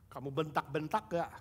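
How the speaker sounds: normal talking voice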